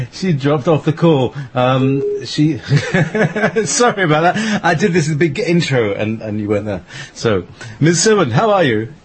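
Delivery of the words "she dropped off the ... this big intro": high, real embarrassed laughter through the words; laughing it off. Real, not acted. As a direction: laugh at the mishap while apologizing. Guest dropped off the call.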